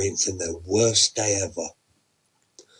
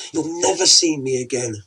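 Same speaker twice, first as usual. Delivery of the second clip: plain reading voice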